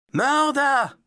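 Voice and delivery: high, far-off shriek of a puppet maid; maid's cry. Acted. The maid puppet accuses the guard with a high shriek. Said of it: "Der Ausruf war von der Magd gekommen".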